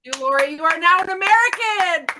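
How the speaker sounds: loud, rising ceremonial shout; mock proclamation. Bestowing American status on Hugh Laurie, mock proclamation. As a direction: proclaim it high and loud, like a knighting.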